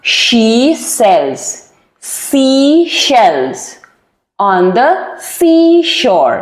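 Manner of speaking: "she sells", "seashells", "on the seashore" as separate chunks with pauses between; word by word. Shown as a demonstration. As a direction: break the tongue twister into slow pieces. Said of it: "एक एक word करके अगर आप practice करें ना".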